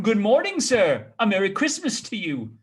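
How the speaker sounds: bright, loud holiday greeting; jolly passers-by calling out; cheery greeting. Acted. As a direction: hail him heartily. Good-humored fellows greet Scrooge.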